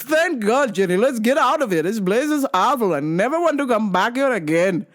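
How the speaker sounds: high, sing-song complaint; hammed-up dad voice; relieved griping dad. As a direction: imitate dad's fussy exasperation. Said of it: "my dad's like".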